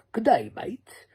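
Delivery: high-pitched hammed-up Australian greeting; a quick mock Aussie good day; tossed-off fake Australian hello; mock Aussie accent. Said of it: "was that British or was that Australian?".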